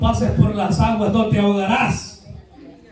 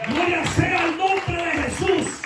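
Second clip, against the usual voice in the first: climbing from slow and high to a shouted, much higher praise; full-force preaching; 7 semitones higher than usual. Acted. Preacher rising to a shouted glory for the congregation.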